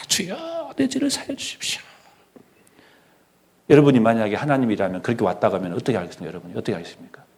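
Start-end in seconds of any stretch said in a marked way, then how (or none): 0.1-1.8 high, soft mock plea for forgiveness; mock tearful prayer. Preacher mimics crying penitents at church, high soft plea. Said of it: "사람들 하도 우니까".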